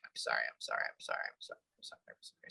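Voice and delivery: a hushed, sorrowful repeated sorry; sad apologizing. Retold or imitated. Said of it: "Very sad, keeps apologizing".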